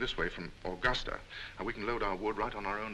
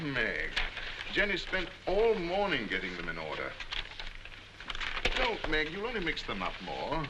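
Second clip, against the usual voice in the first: raised, sharp and impatient; irritated snap; 7 semitones higher than usual. Acted. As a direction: snap at her in frustration. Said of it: "I didn't mean to shout".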